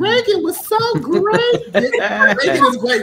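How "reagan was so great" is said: high mock praise of a lost friend; mock eulogy. Host mocking a posthumous tribute to Reagan, high and gushing. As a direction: gush it up high like a hammy tribute. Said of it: "Don't wait until they're like".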